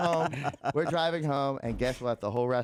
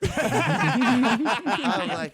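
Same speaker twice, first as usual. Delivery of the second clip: laughing loudly through the words; 10 semitones higher, 7 dB louder, much slower than usual. Real, not acted. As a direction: burst out laughing mid-story. Laughing while retelling panic.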